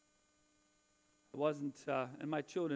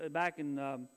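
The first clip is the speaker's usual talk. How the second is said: ordinary delivery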